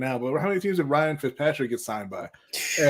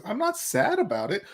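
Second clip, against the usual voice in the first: mocking quote; 6 semitones higher than usual. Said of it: "your reaction is".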